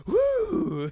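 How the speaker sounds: high, loud woo of relief; 1 mock relieved cheer. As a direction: whoop like you've escaped. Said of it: "I'm like".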